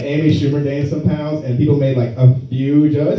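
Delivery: ordinary talk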